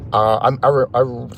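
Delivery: stuck on the first sound, repeating it; stutter, can't get the word out. Real, not acted. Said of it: "I'm stuttering".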